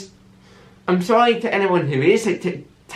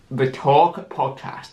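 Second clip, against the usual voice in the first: normal talking voice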